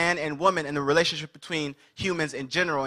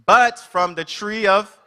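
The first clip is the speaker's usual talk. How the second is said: slow, loud, raised proclamation; God voice; 4 semitones higher, 6 dB louder, slower than usual. Acted. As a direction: declare the command slowly, as God. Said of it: "we're still reading in God voice".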